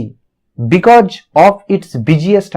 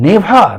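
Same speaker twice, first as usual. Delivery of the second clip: "Never" hit hard, a little higher, falling; emphatic never; 5 semitones higher, 3 dB louder than usual. Shown as a demonstration. Teacher stressing the poem's opening negative word. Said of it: "begins such a negative word".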